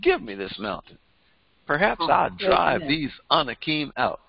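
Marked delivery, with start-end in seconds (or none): none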